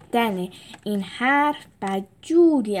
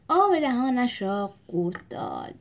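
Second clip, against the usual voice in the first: ordinary delivery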